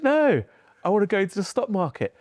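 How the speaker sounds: put-on proud young self. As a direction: correct her with pride. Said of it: "I said".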